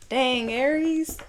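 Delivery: high, slow wondering dang; drawn-out dang. Real, not acted. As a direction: draw it out, shaking your head. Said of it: "repeating the reaction".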